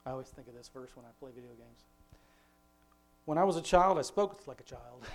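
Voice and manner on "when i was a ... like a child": scripture reading. Acted. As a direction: read the verse aloud with gravity. Reads scripture verse.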